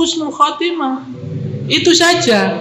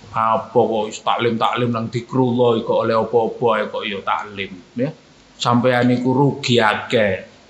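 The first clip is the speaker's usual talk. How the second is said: mocking neighbour voice; 11 semitones lower, 3 dB quieter than usual. Mocking voice of the critics.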